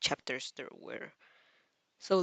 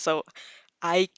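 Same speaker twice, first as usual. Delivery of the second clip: usual speaking voice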